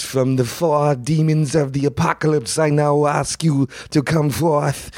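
high, slow, breathy summoning of demons; Werner Herzog impression. Imitating Herzog summoning demons from hell in a concert intro. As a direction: intone it like Herzog calling up demons. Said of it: "And he goes".

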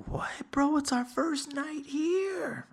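high-pitched, drawn-out whine of disbelief; imitating incredulous friends; mock protest. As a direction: protest way up high like let-down buddies. Friends protest his leaving.

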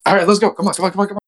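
high, rapid-fire herding; acted-out frantic urging; urgent hurrying. Hurrying friends away from danger.

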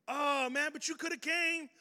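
high, whiny protest; mock whiny friend. A friend excusing himself to the uninvited guest, high whine. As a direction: whine like a friend who never invited you.

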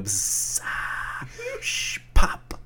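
buzzy frying sizzle, then a pop; sizzle and pop sounds. Shown as a demonstration. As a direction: make the sound of food frying. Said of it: "we can make, like, the pop and sizzle sounds".

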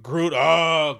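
loud drawn-out "oh"; exaggerated cry of dismay; mock outcry. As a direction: wail it out, hammed up. Mock outcry at Groot's death.

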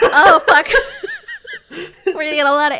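real helpless laughter through the words; laughing. Real, not acted. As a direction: talk while cracking up. Cracking up at own slip.

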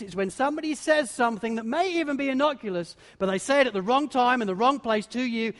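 normal talking voice

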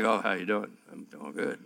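a hint of a timid churchgoer; meek small talk. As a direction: go tiny and polite. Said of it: "we're like".

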